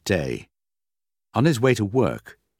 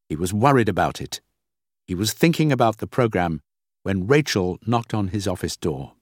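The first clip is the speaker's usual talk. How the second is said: usual speaking voice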